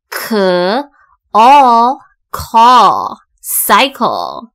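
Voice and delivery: slow, loud, separated k, all, call, then cycle; sounding out. Shown as a demonstration. Teacher demonstrating L E, sounding out cycle. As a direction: build cycle from k and a drawn-out all. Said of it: "L E一起念成all".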